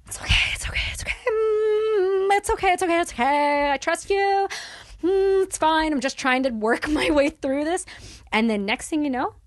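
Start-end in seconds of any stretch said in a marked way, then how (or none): 0.1-1.1 breathy whisper, soothing himself on the toilet; whispered self-soothing. Retold or imitated. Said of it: "I just go". 1.3-5.9 higher, gentle self-reassurance; soothing himself. Retold or imitated. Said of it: "I just go".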